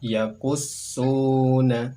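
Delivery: Yakussuna very slow, ss held; slow shadda demo. Shown as a demonstration. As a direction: stretch Yakussuna for learners. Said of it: "contoh pelafalan kami".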